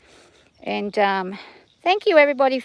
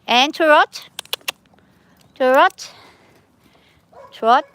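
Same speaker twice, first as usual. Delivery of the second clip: higher, louder rising call; called command; 4 semitones higher, 4 dB louder, much slower than usual. Real, not acted. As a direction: lift the word trot up. Calling commands to her horse.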